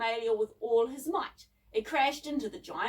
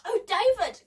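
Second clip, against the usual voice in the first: high, loud gasped exclamation; startled girl's cry, pitched up; alarmed gasp; 10 semitones higher, 7 dB louder than usual. A narrator's reading. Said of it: "gasped Debbie".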